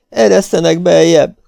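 high, loud plea; desperate pleading. A narrator's reading. Fugitive begs the men as danger nears, high and loud. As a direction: beg hard to be let in. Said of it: "könyörgött Marvel".

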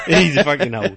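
laughing while saying it. Real, not acted. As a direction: chuckle through the words. Laughing after being corrected.

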